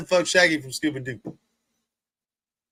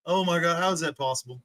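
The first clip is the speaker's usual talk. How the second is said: ordinary talk, nothing marked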